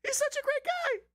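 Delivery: squeaky falsetto mock voice, far above normal; cartoonish high-pitched caricature; Cenk Uygur impression. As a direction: parody him in a tiny high squeal. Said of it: "That's my Cenk Uygur impression".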